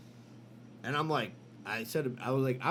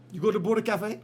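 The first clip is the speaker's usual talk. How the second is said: put-on higher, louder Indian accent question; the doctor asking it in an Indian accent, curious; 6 semitones higher, 5 dB louder than usual. Doctor asks the patient about the restaurant in an Indian accent. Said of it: "Your impression of Indian guys".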